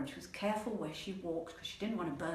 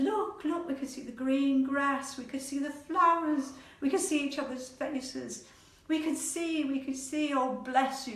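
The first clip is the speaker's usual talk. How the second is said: high, raised, joyful exclaiming; excited wonder; 7 semitones higher, 4 dB louder than usual. Acted. Animals seeing grass and flowers in sunlight, excited wonder. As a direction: marvel aloud, voice lifted throughout.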